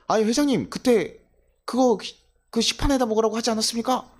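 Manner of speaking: indignant employee's objection; protesting. Retold or imitated. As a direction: argue back at the chairman. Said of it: "라고 따져요?".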